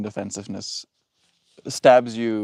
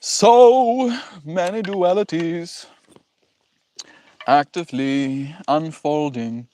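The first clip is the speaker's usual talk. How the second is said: slow, higher, dreamy oracle voice; mystical intonation; 5 semitones higher, slower than usual. Pretend. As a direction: intone it like a guru.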